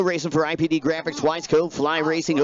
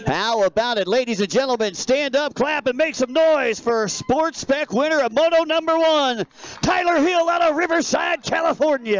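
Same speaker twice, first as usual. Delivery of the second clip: hype announcer; 8 semitones higher than usual. Acted. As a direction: pump up the crowd for the winner. Announces the race winner to crowd.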